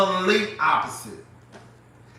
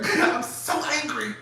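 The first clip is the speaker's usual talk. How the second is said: slow, higher mock-angry voice; 5 semitones higher, 4 dB quieter than usual. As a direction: mimic her mild anger, stretching the words.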